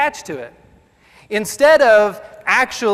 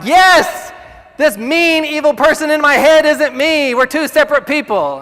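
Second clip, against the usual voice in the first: higher, louder, triumphant eureka; excited realization; 6 semitones higher, 5 dB louder than usual. Retold or imitated. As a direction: burst out with relief that it's not you. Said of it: "I was like".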